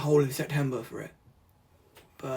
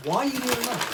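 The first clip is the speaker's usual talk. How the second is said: high, light mock viewer asking; imitating a puzzled viewer; 8 semitones higher, faster than usual. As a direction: play the baffled viewer, lightly. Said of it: "You're thinking".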